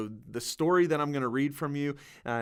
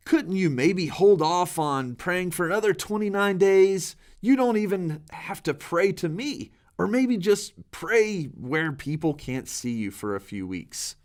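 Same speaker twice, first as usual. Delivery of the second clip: raised, higher, begging king; pleading king; 7 semitones higher, 5 dB louder than usual. Acted. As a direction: plead with a loyal friend to bend the rules. King begs Daniel to hide.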